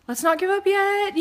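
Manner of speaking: high, loud mock-frantic interjection; urgent pleading. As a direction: jump in to halt a meltdown, hammed-up. Urgently talking the wife round.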